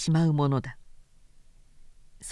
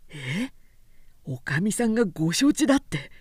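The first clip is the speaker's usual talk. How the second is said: taken aback. A narrator's reading.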